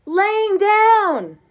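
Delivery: very high, loud, strained shout; all-night quarrel, strained screaming. A narrator's reading. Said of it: "argued all through the night".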